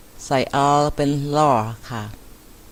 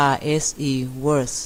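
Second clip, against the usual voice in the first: "worse" spelled letter by letter, very slowly; slow spelled-out demo; much slower than usual. Shown as a demonstration. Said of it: "สะกดกันเลยนะคะ". (สะกดช้าๆ แล้วออกเสียง worse)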